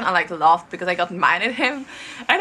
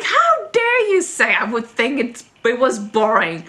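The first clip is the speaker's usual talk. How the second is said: retold snapping; 3 semitones higher, 5 dB louder, slower than usual. Fan retelling her reply about a boring show, mock snapping. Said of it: "I snapped".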